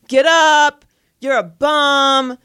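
loud, drawn-out heckling; retold heckler yelling. Woman in the stands yelling at an injured player, mock yell. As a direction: imitate a yelling heckler, stretching each insult. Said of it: "yelling. She's like".

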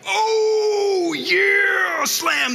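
high whooping shout of triumph; crowd whooping. Retold or imitated. Pastors cheering the punchline, whooping loud. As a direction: holler like a crowd going nuts. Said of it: "They're like".

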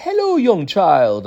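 high, slow greeting; genie-like grandpa. Retold or imitated. Grandpa looks at a young child, high slow genie voice. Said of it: "like, a fairy or, like, like the genie".

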